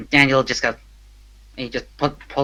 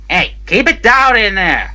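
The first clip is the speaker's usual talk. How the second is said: loud, raised order to noisy prisoners; guard calling out; 7 semitones higher, 8 dB louder than usual. Acted. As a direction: call sharply into the cells.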